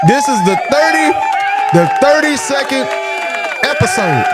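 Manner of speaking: showman's buildup to the episode count; excited announcement. Real, not acted. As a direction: hype up the episode number like a host.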